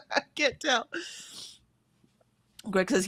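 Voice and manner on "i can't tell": laughing. Real, not acted. Laughing while saying it.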